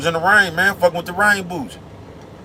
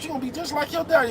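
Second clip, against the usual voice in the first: much higher, fast nagging mom voice; scolding mama; 12 semitones higher, 6 dB quieter, faster than usual. Retold or imitated. Mama scolding her son about selling drugs, high nagging voice. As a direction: nag him like a fed-up mother. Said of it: "Talking about".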